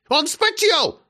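put-on high, fussing old woman; grandma panicking he'll catch cold; alarmed grandma. A narrator's reading.

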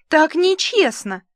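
high, loud protest; yelling. Acted. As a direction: yell it in outrage. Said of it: "заорала Клосс".

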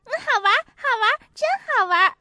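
high, loud gleeful crowing; gleeful shouting. A narrator's reading. Boy crowing over the hit monkey, gleeful high shout. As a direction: crow with delight, a bit fast. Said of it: "嚷着".

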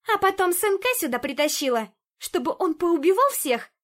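high, loud accusing shriek. A narrator's reading. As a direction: shriek it out in outrage. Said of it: "взвизгнула Лада".

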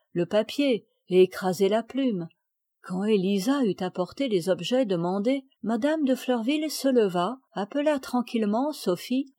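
plain reading voice

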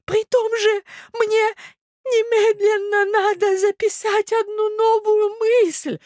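very high, slow plaintive voice; old prince, flustered. Acted. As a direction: beg for rescue as a muddled old man. (старый князь, растерянно и жалобно, высоко)

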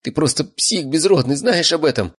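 put-on high, upset girl's voice; tearful accusation. A narrator's reading. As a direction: accuse him in a high, distraught voice. Sobbing girl continues accusing gang leader. (высокий расстроенный девичий голос)

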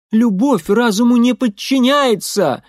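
high, loud passionate cry; passionate exclamation. A narrator's reading. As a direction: proclaim it with fervour. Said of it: "воскликнула Нэнси со страстью".

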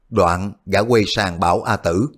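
usual speaking voice

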